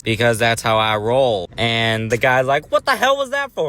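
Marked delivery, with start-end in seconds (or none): 2.7-3.7 squeaky, much higher outraged dad; the slapped dad squeals his protest, way up high; high-pitched offended father; indignant dad. Retold or imitated.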